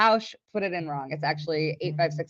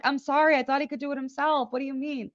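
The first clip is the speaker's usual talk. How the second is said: defensive apology; 7 semitones higher than usual. Retold or imitated. Acted defence against angry caller.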